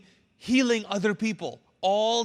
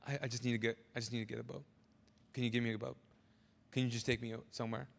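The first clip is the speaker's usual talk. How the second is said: very low, hushed, pleading, quicker at the end; imagining Jesus; 9 semitones lower, 12 dB quieter, much faster than usual. Retold or imitated. Preacher voices grieving Jesus pleading to be taken out, hushed. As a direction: plead quietly like someone desperate to escape. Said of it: "could you imagine Jesus going".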